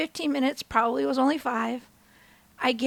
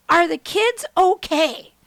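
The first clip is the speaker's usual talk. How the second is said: louder, drawn-out husband's question; slow, deliberate question; 3 semitones higher, 6 dB louder, slower than usual. Retold or imitated. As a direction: ask it slowly, stressing every word. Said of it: "He goes".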